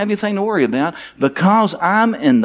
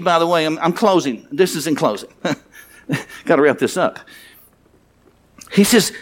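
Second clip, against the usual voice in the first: usual speaking voice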